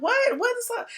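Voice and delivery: high, soft, puzzled aside; retold bewilderment.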